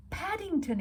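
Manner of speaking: high, excited cry of the name; Judy exclaims at the sight; Judy exclaiming, a touch exasperated. A narrator's reading. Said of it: "exclaimed Judy".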